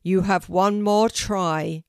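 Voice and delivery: loud, weighty warning; booming tree teacher. A narrator's reading. Oak tree warns Kelty of her last try, booming. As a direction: boom out the final chance. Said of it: "said a deep, loud voice".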